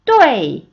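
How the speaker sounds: 뚜에이 as one loud syllable sliding sharply down; falling fourth tone. Shown as a demonstration. Teacher demonstrating fourth tone, 뚜에이 loud and falling. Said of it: "한 번 더".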